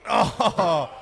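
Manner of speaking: loud, high drawn-out "oh"; excited outcry. Real, not acted. Commentator watching a wobbly log, excited outcry. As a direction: cry out at the near miss. Reacting to a near-miss lift.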